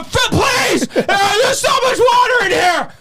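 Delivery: panicked pleading. Retold or imitated. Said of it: "And they go".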